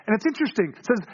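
ordinary delivery

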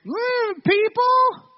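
put-on high, slow, drawn-out child echo; little son's voice. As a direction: mimic a small kid copying dad. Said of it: "in this little faint voice behind me".

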